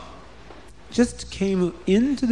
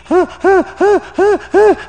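high, loud bursts of screaming; repeated high-pitched wailing "ha"; 5 dB louder than usual. A narrator's reading. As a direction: scream in short, even bursts. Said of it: "he was screaming".

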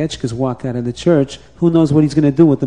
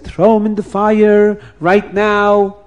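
slow, raised demand; mob demand; 6 semitones higher, slower than usual. Acted. Mob ordering the boy thrown in the fire, slow and raised.

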